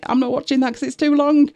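high-pitched whingy mimicry; whingy complainer. Creator imitating a viewer refusing a long video, whingy. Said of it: "in a very high-pitched and insignificant whingy voice".

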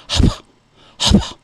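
imitated breathy dog pre-bark; short voiceless huffs, like a dog barely barking; mouthed pre-bark. Owner shows how the dog threatened to bark, mouthed bark. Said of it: "just kind of mouth a bark".

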